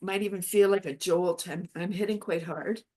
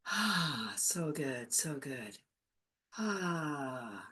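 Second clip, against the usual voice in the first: soft, slow meditation voice; guided meditation; 4 semitones lower, 8 dB quieter than usual. Acted. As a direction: ease them into calm. Guided calm.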